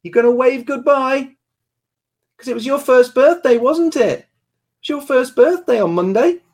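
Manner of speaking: high, singsong parent voice. Real, not acted. Talking to child about birthday.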